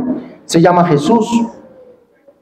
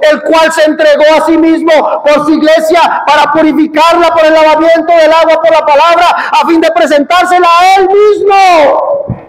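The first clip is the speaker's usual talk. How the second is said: full-force preaching; 8 semitones higher than usual. Acted. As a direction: thunder it at the congregation.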